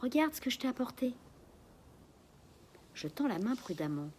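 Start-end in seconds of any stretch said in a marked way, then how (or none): 0.0-1.1 coaxing. A narrator's reading. Coaxing the parrot with biscuits.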